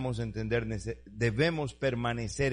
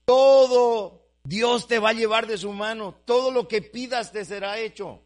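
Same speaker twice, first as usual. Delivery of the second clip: full-force preaching; 8 semitones higher, 10 dB louder than usual. Acted. Preacher proclaims the promise to his brothers, loud and lifted.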